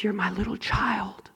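whispering God; God whispering to his child; whispered. Retold or imitated. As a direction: breathe it softly as God speaking. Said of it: "God says".

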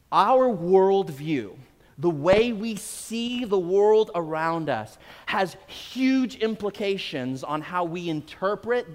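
slow, weighty sermon delivery; preaching. Acted. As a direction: drive the lesson home slowly. Drawing the sermon lesson.

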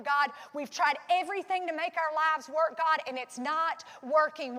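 full-force preaching. Acted. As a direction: pour it out at full volume. Closing prayer builds to full force.

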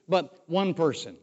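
usual speaking voice

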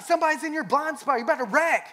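high, urgent passenger warning; imitated yelling. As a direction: mimic a wife yelling at the driver. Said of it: "she's yelling at me".

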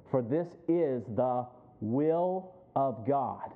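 drawn out slow, each word weighed; slow emphatic reading. Acted. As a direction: lean on every word of scripture. Preacher lingers on scripture line.

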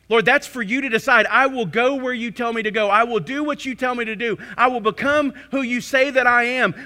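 loud, surrendering prayer voice, slowing on the final titles; impassioned preaching into prayer. Acted. Preacher voicing a believer's surrender to Christ as Lord, loud and slowing. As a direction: pour it out as a vow to the Lord.

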